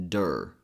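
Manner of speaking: "der" said loosely with a schwa and r; relaxed third syllable. Shown as a demonstration. Teacher models the third syllable of calendar, relaxed "der". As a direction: give "der" a relaxed, unstressed vowel. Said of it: "Again, a very relaxed sound".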